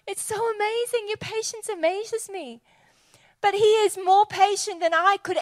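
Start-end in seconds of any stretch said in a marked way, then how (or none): 0.0-2.6 put-on young self in awe.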